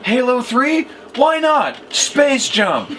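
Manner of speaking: hammed-up product pitch; Billy Mays pitch. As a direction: sell it like a shouting TV pitchman. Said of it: "Billy Mays here for Space Jump".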